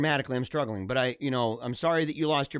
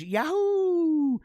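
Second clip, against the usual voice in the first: high whooping yahoo, much higher; cheering; 13 semitones higher, 4 dB louder than usual. Real, not acted. Reading a viewer's comment, whooping with joy. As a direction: let out a joyful whoop.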